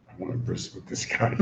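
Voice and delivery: breathless hushed aside; a soft conspiratorial whisper. Acted. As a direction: mutter it to yourself. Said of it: "So Avimelech says".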